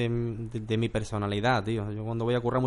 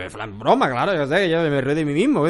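mock protest; 3 semitones higher, 6 dB louder than usual. His joking defence retold.

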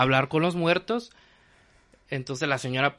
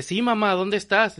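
higher-pitched retold child's call; son calling mother; 7 semitones higher, 4 dB louder than usual. Dead son answers his mother through the door, lightly imitated calling. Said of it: "Y le dice".

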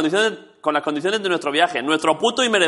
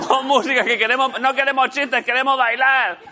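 high-pitched mock crowd demand; público exigente en broma; 7 semitones higher than usual. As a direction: clamour like a rowdy audience wanting a party. Imita al público pidiendo baile. (voz aguda de público reclamando)

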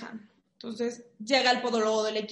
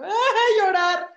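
mock wail; 9 semitones higher, 8 dB louder than usual. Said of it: "yo me puse a llorar".

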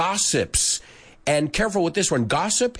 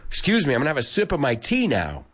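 usual speaking voice

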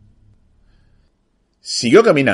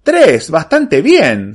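high, drawn-out delighted praise; enthusiastic grandmother; 5 semitones higher, 4 dB louder, much slower than usual. A narrator's reading. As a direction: praise it slowly with big lifts. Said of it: "se entusiasmó la señora".

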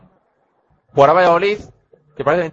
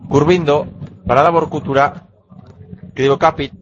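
ordinary delivery

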